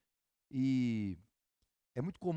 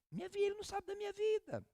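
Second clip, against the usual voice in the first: high mock whine of a doubter; mocking churchgoer; 13 semitones higher, 4 dB quieter, faster than usual. Pastor mimics a doubter dismissing the preacher, high mock voice. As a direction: squeak it out like a scoffing member.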